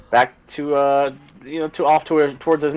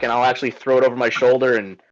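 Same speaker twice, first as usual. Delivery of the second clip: normal talking voice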